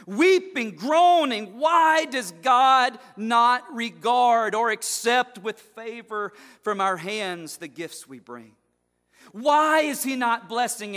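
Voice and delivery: loud, high outcry; preach. Acted. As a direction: cry out the worshipper's confusion. Preaching the charge.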